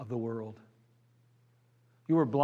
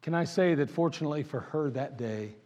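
usual speaking voice

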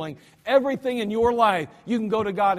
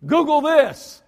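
emphatic shout; 6 semitones higher, 8 dB louder, slower than usual. Real, not acted. Preacher holds up Bible, exclaims.

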